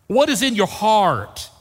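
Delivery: usual speaking voice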